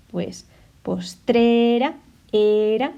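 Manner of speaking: postrera slowed, with era drawn out and loud; stressing the rhyme. Shown as a demonstration. Teacher showing students the rhyme, stressed slowly. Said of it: "¿lo veis?".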